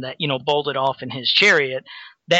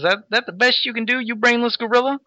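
higher, mocking hero taunt; 8 semitones higher than usual. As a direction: jeer at the brute. He-Man taunting Beastman in comic.

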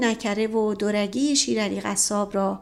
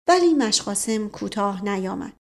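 ordinary delivery, nothing marked

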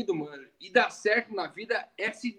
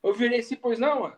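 ordinary talk

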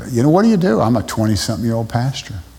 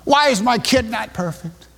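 whining at God; 9 semitones higher than usual. Retold or imitated. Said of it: "don't we act like that, that way to God".

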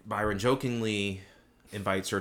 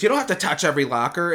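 bossy teacher; 6 semitones higher, 7 dB louder, faster than usual. Retold or imitated. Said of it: "She goes".

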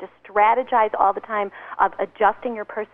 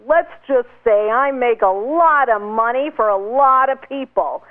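slow, louder smug boast; bragging tone; 3 semitones higher, 6 dB louder, slower than usual. Retold or imitated. A networking guy bragging to a stranger, slow smug boast. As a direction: brag it out like the big earner at the party. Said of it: "Mike had a very bragging tone".

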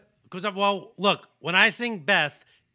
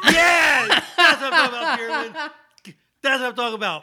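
loud, high whoop of joy; excited cheer; 8 semitones higher, 10 dB louder than usual. Real, not acted. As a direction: celebrate like you scored. Cheering after the bit.

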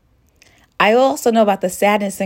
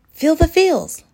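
emphatic self-talk; 9 semitones higher than usual. Retold or imitated. Telling herself again, firmer and higher. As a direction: push the reminder harder the second time. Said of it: "I remember thinking to myself".

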